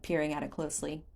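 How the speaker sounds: plain reading voice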